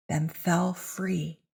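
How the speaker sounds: plain reading voice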